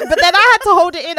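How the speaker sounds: ordinary talk, nothing marked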